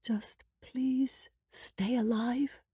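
high, soft, drawn-out plea; hushed, high, lingering entreaty; tearful whisper. A narrator's reading. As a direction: plead slowly and softly through tears. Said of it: "she whispered".

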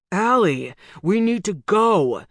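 loud, pressing call; urgent shout. A narrator's reading. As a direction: shout to get him moving.

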